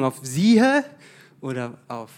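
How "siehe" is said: „Siehe“ lifted high and loud; stress on Siehe. Shown as a demonstration. Preacher demos stress on „Siehe“ to the congregation. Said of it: "Wo war die Betonung?".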